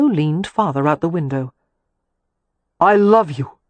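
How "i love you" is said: said shortly. A narrator's reading. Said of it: "he said shortly".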